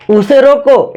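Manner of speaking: high "उसे रोको", falling at the comma; stop after रोको. Shown as a demonstration. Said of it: "यहाँ comma लगाया था".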